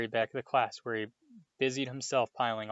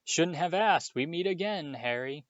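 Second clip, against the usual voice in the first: jovial thunder; 6 semitones higher than usual. A narrator's reading. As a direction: boom it out like a showman. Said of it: "thundered jovially".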